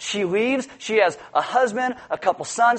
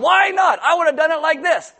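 high, loud protest at God; hammed-up know-it-all; mock indignant whine; 7 semitones higher, 3 dB louder than usual. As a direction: play the know-it-all telling God how. Impersonating person arguing with God.

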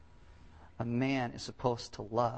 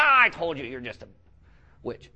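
mock angry outburst; 11 dB louder than usual. Preacher mimicking a husband blowing up at his wife, mock anger. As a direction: explode like a husband losing it. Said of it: "they'll get all mad".

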